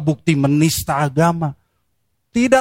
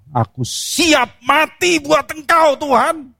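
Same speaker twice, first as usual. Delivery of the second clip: much higher, slow, earnest pledge; Peter's bold vow; 11 semitones higher, slower than usual. Retold or imitated. Preacher voicing Peter vowing loyalty to Jesus, high and slow. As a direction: swear it slowly, chest out. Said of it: "Petrus berkata".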